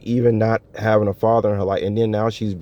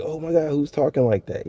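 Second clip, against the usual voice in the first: high, softer excited reaction; 6 semitones higher, 4 dB quieter than usual. Acted. Man reacting to hearing a Southern accent, excited. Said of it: "I'm just already excited".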